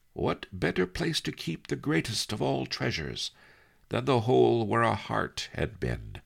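put-on a sly old scholar savouring the joke; lightly voiced old Master Li, amused; old sage Master Li. Li Kao quote in story.

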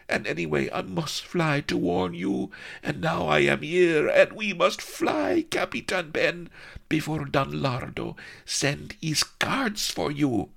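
high, pleading and urgent; urgent foreign girl. Acted. Melliflua urging Captain Ben to flee, high and pleading. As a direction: beg him to flee at once.

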